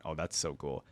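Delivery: ordinary talk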